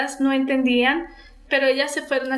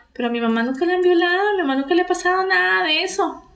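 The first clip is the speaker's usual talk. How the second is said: higher, rushed, insistent protest; fast raised-pitch denial, retelling an upset sister; retold indignant denial; 6 semitones higher, much faster than usual. Older sister contradicting the younger about their mother, retold indignant denial.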